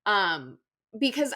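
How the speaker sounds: ordinary talk, nothing marked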